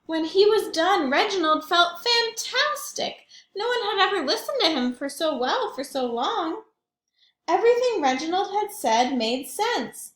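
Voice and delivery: put-on bright, lively read-aloud to kids; storytelling voice. A narrator's reading. Reads story with lively narration.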